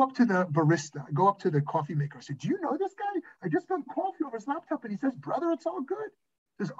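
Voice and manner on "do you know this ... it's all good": high-pitched incredulous questioning, acted out; retold bewildered report; mock bafflement. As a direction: ask as if you can't believe it, pitch way up.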